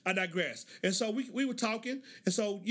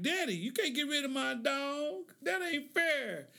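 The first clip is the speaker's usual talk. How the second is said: protesting daughter; 6 semitones higher, slower than usual. Retold or imitated. Daughter protests losing her dog.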